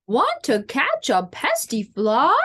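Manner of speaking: crowing question with a big upswing; rooster voice. Acted. Rooster invites spider to catch a fly, crowing voice. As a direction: ask it like a strutting rooster. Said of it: "crowed the rooster".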